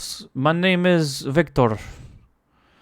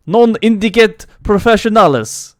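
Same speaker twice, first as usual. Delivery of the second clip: put-on high, loud Russian accent; 7 semitones higher, 9 dB louder than usual. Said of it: "In Russian accent".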